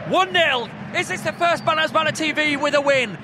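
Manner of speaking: loud, high, jubilant announcing; excited commentary. Real, not acted. Commentator hopes for a first win, excited. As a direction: project over the crowd. Live commentary after goal.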